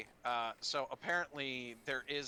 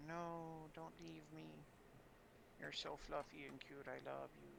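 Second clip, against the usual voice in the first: soft, higher-pitched doting pet talk; tender cooing; 5 semitones higher, 10 dB quieter than usual. Real, not acted. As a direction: coo a gentle plea to your cat. Tenderly pleading with the cat.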